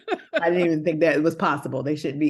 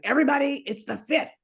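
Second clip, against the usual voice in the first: higher, stern announcement; dragon lady; 8 semitones higher than usual. Retold or imitated. To all her roommates on rent day, mock stern. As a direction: call the house to order. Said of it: "dragon lady in my household".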